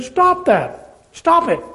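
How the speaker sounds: a stern divine order, voiced lightly; God commanding. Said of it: "that's what God said".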